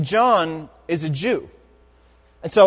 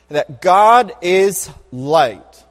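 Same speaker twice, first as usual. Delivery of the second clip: slow, weighty stress on each word; slow emphatic; 5 dB louder, much slower than usual. Acted. Preacher to congregation, slow weighty emphasis. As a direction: lean into it slowly and let it land. Preacher savoring key phrase.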